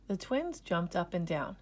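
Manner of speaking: plain reading voice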